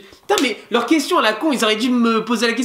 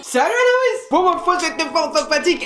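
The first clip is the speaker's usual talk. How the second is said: very high-pitched put-on voice; hammed-up high hello; mock greeting; 7 semitones higher than usual. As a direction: squeal an imagined greeting. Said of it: "tu m'aurais dit".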